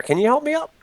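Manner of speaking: very high, pleading little voice; helpless Mister Rigby. Acted. Rigby asks Yamdo for a lift, high and helpless. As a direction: ask for help in a squeaky, silly voice.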